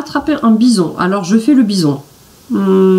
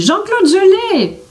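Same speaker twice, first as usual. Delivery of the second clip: much higher, slow triumphant cry; 13 semitones higher, slower than usual. A narrator's reading. Billy telling Jean-Claude he caught it, triumphant. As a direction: stretch it out in pure glee. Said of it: "s'exclame Billy".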